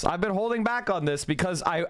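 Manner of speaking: normal talking voice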